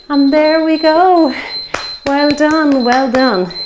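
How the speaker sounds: breathless after exercise; out of breath. Real, not acted. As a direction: gasp it out, winded. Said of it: "I'm out of breath after that".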